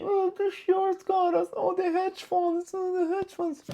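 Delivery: high, soft whining mock; whiny complainer. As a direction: mimic a trader crying about hedge funds. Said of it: "just saying".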